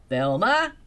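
loud shout of her name. Acted. Principal shouting for Velma across the park. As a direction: call her name out sharply. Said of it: "shouted Principal Crossley".

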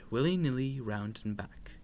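usual speaking voice

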